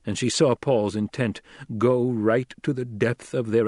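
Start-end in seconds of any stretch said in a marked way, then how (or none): none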